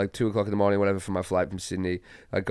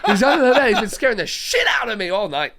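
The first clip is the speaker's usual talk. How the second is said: high, loud cry through laughter; retold shocked discovery, pitched way up and laughing; laughing outburst; 13 semitones higher, 5 dB louder than usual. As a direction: blurt out the realisation while cracking up. Blurting out the punchline, laughing.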